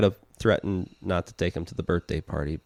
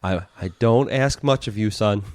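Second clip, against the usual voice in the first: mock stern dad; 3 semitones higher, 4 dB louder than usual. Parody stern dad lecture.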